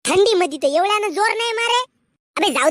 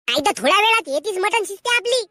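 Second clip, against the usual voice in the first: usual speaking voice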